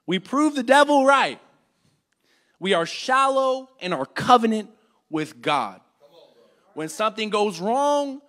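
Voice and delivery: normal talking voice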